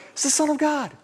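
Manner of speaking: high insistent blurt; eager insider. Retold or imitated. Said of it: "And we're like".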